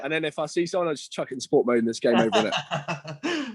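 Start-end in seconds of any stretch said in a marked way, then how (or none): 2.3-3.5 laughing. Real, not acted. Laughing at own boast.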